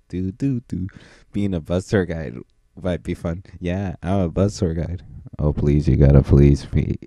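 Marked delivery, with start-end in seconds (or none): none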